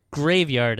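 usual speaking voice

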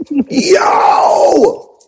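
a joyful yell, loud and high; excited shout. Retold or imitated. In the car celebrating the offer, an excited high yell. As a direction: shout it out like you just got rich.

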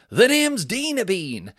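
big, high, stretched-out name announcement; loud mock comic introducing himself; Dino Bean. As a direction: hammer your own stage name like a showman. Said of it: "Dino Bean goes on stage and he goes".

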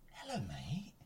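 soft whispered greeting; whispering. Real, not acted. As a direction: whisper hello to the cat. Whispering to the cat.

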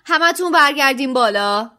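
loud, high, bossy order; Percy rushing in ordering everyone upstairs, hurried command; hurried prefect. A narrator's reading. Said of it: "با عجله وارد سالن عمومی شد و گفت".

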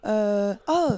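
put-on French-accented English, chatty tourist; English self-introduction. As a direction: introduce yourself to backpackers. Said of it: "faire une petite présentation en anglais".

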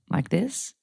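a soft, light American accent. Shown as a demonstration. Testing her American accent on her partner, softly. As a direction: ask it quietly in American. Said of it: "How do I do an American accent?".

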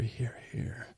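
imitating a hushed whisper; barely audible whispered aside; quiet whisper. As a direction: drop to a very soft whisper. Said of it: "it's a quiet whisper by [name]".

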